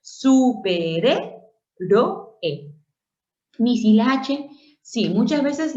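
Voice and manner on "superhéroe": Superhéroe stretched as su-pe-ré-ro-e; syllable by syllable. Shown as a demonstration. Teacher showing R covers the E, syllable by syllable. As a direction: slowly split Superhéroe with R opening the syllable. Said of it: "esta R tiene que cubrir a la E".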